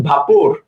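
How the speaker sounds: "ভাপর" as BHA-por, read as spelt; spelling pronunciation. Shown as a demonstration. Teacher gives a memory aid for spelling, spelt-out "ভাপর". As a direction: say "ভাপর" with an open "a" first syllable. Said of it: "বানানটা মুখস্থ রাখার জন্য বলতে পারেন".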